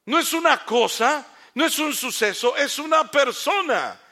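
loud, high, slow proclamation to the congregation; full-force preaching. Acted. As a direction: preach it out, hammering each phrase.

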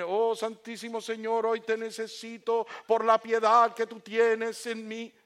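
high, monotone, drawn-out holy intoning; pompous prayer. Shown as a demonstration. Contrast with honest prayer to God, pompous intoning. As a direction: intone it grandly, stretching every phrase. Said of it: "No es lo mismo".

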